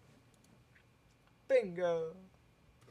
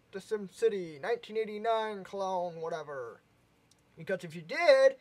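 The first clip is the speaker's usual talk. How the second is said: louder upbeat sign-off to the viewers; YouTuber outro; 4 semitones higher, 9 dB louder than usual. Acted. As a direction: wrap up the video for viewers, bright and loud.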